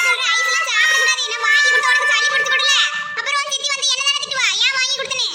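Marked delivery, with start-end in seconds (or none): none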